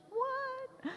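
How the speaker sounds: high-pitched incredulous squeal; mock disbelief. Lecturer reacts to inconsistent translators, in mock disbelief. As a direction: squeak it out in comic astonishment.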